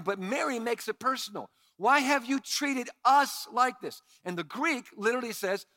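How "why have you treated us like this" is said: higher, louder accusing question; accusing mother. Retold or imitated. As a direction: throw the blame back at her son. Mary's accusing version, louder.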